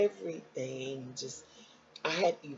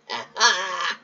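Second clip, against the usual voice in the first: short laugh; 4 semitones higher, 11 dB louder than usual. Real, not acted. Laughing at her own joke.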